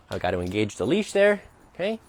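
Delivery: ordinary delivery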